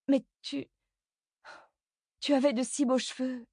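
high, halting mother's voice; falter, then lament in a high voice; dismayed mother. A narrator's reading. Horrified mother mourns son's haircut. (mère consternée, voix aiguë)

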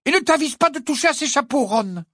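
fast, high, loud warning; angry warning. Acted. Hermione warns Ron off, angry. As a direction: fire the warning off.

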